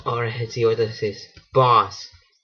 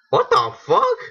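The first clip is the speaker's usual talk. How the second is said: shocked outburst. Real, not acted. Startled by the game.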